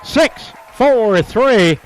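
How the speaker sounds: Relay, bingo! shouted high and loud as the double play turns; play-by-play announcer thrilled by the inning-ending double play, loud and high; excited play call. Real, not acted.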